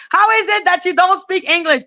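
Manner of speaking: very high mimicking voice; mocking imitation. Mimicking a man asking why she lacks English, mocking imitation. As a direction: parody his question way up high. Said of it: "then was like".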